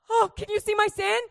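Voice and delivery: high, gasping mock alarm; hammed-up worried squeak; found-out panic. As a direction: gasp as though your secret is exposed. Acts being found out.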